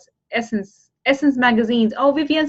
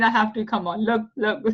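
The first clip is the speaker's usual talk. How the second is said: ordinary talk, nothing marked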